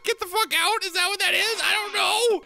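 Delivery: high-pitched frantic wailing questions; panicked. Real, not acted. Player attacked in a horror game, panicking.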